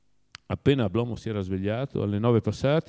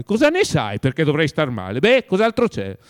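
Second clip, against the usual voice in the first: loud exasperated challenge; irritated master quizzing his servant; 5 semitones higher, 9 dB louder than usual. A narrator's reading. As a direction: cut him off, louder and higher as you go.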